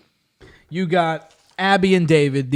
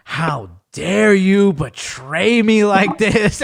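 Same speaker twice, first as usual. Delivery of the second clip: slow, drawn-out dramatic indignation; theatrical, stretched-out accusation; mock outrage; 2 semitones higher, much slower than usual. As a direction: play up a betrayed son's outrage. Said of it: "And he was pissed".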